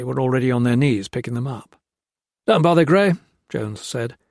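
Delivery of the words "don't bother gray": quick, higher and louder; hasty reassurance. A narrator's reading. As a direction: brush it off fast. Jones hurrying to cover.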